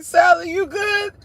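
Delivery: high, slow, put-on concerned friend; mock concern. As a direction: stretch it out in a prissy friend voice. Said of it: "She was like".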